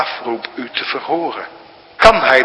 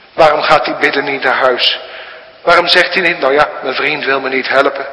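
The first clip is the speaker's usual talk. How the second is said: higher, louder questioning sermon; fervent preaching; 4 semitones higher, 6 dB louder, faster than usual. Acted. Preacher challenging the congregation, fervent and loud. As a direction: press the question hard.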